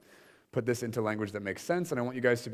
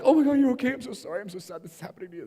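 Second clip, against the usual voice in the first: mock gushing sympathy; 10 semitones higher, 5 dB louder, faster than usual. Speaker mocks the pity response she avoided, higher and gushing. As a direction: ham up the gushing sympathy she didn't give. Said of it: "So I wasn't like".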